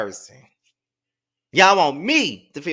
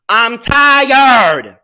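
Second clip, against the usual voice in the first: exasperated; 7 semitones higher, 10 dB louder than usual. Real, not acted.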